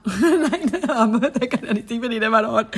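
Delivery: giggling correction; laughing backtrack. Real, not acted. Admitting she still fancies him, laughing. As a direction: laugh while taking it back.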